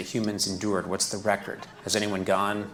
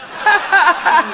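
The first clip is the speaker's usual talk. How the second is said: big burst of laughter; loud laugh; 14 dB louder than usual. Real, not acted. Laughing mid toilet bit.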